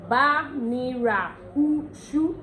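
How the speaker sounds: very slow, "ushu" as oo-shoo; teacher's slow model of Ondo "ushu"; Ondo pronunciation. Shown as a demonstration. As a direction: draw out each syllable up to "ushu". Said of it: "That's ushu for you".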